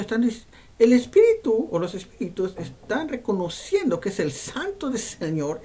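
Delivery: ordinary delivery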